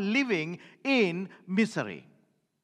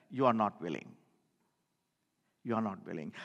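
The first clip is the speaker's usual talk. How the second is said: much lower and softer, sinking away; sorrowful murmur; 13 semitones lower, 8 dB quieter, faster than usual. Real, not acted. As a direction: let it fall into grief. Preacher laments unwilling listeners.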